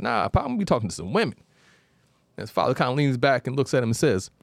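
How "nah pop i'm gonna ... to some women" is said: lightly voiced eager son, high and fast; a boy bragging to his pop, quick and high; cocky teen son. Son answers father in folktale.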